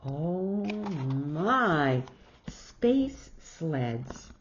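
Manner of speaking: plain reading voice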